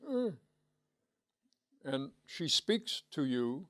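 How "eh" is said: short high-pitched "eh"; a small high wordless baby sound; imitated baby noise. Lecturer voicing a baby seeing mother arrive, imitated baby noise. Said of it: "and you go".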